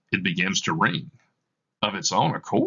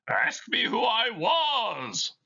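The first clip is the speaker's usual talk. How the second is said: ghostly Marley voice; slower than usual. Acted. Dead partner answering Scrooge, eerie high ghost voice. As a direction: moan it out like a spirit.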